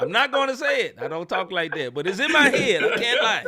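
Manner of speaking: high and loud through laughter; laughing. Real, not acted. Cohost cracking up over a Dave Chappelle joke, high laughing. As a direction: crack up while getting it out.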